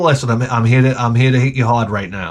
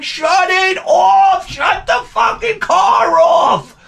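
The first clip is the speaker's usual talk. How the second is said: very high, slow full scream; much slower than usual. Retold or imitated. Husband screaming at wife to kill the engine. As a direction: scream it at the top of your lungs. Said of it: "at that volume".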